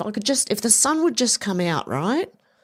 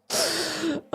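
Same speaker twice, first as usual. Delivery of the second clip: high laugh, then quieter; 9 semitones higher, 5 dB quieter than usual. Real, not acted. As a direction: laugh heartily. Laughs at WD forty joke.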